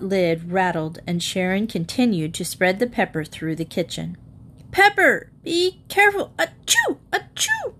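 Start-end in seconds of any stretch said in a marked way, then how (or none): 4.7-6.3 higher, louder alarmed shout; alarmed cry. A narrator's reading. Mother warning Sharon about the pepper, alarmed shout. 6.4-7.7 two high acted sneezes. A narrator's reading. Mother caught in the pepper, sneezing.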